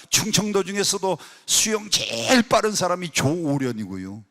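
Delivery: ordinary delivery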